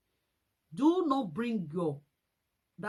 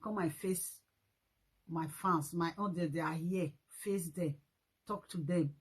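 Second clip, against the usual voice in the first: lower, quiet mock order; soft offhand command to sit and face fans; scornful low instruction; 5 semitones lower, 5 dB quieter than usual. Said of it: "tell the woman".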